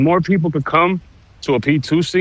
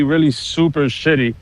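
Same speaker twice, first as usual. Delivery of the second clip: ordinary talk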